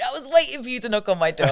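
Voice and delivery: high, loud, rushed exclamation with laughter; mock exasperated outburst. Guest re-enacting the director answering the knock, exuberant outburst. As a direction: blurt it out fast and high. Acting out the director's eager welcome.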